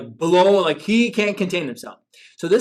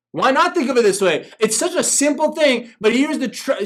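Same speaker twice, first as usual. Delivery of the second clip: ordinary talk, nothing marked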